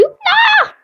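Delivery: high loud cry of protest; desperate shout. Real, not acted. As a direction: scream as the favourite goes. Character eliminated.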